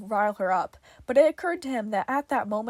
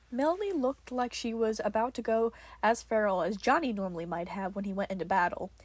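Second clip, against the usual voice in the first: usual speaking voice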